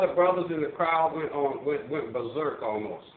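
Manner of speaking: ordinary talk, nothing marked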